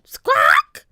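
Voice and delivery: very high, loud bird screech; parrot squawk. A narrator's reading. Polly circling above the pirates, squawking. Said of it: "she squawked".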